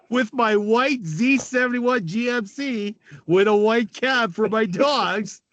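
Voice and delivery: ordinary talk, nothing marked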